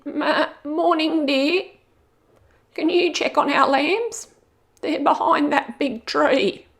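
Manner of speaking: high, sing-song sheep ladies; sweet ewe voices. Acted. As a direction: greet the wolf like kindly old ewes. Ewes speak to the wolf.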